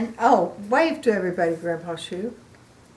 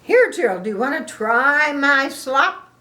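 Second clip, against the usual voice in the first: louder, higher eager offer; generous Piggy offering; 5 semitones higher, 4 dB louder than usual. Acted. Grandma voicing Piggy offering Gerald her slop. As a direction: hold out the bowl happily.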